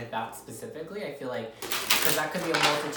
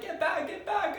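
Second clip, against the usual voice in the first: very high, panicked get back; squealing push-away; high frantic shooing. Pretend. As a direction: shoo them off in a squeal. Voiced overwhelmed viewer.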